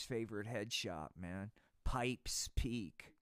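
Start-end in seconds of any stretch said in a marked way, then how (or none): none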